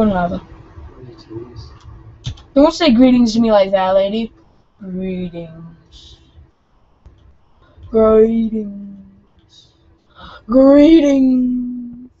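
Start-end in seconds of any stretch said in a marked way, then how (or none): 4.8-6.2 flat robotic greeting; monotone voice. Retold or imitated. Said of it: "speaking to you in a monotone voice". 7.9-9.7 odd flat timbre greeting; monotone voice. Retold or imitated. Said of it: "speaking to you in a monotone voice". 10.5-12.1 loud, slightly higher greeting; louder greeting. Retold or imitated. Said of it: "speaking to you in a monotone voice".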